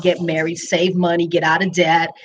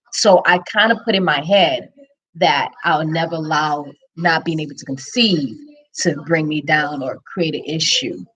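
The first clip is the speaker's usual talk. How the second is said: normal talking voice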